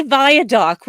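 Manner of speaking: "viaduct" ending in dock, loud; mispronounced as "viadock". Shown as a demonstration. Mocking Utah speakers, "viaduct" said as via-dock. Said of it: "which is not a word".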